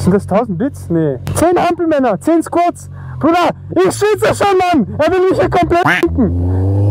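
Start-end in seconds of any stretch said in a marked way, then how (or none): 1.3-6.9 high, excited outburst; pitched up and slow, exclaiming. Real, not acted. Doing jumping jacks and squats.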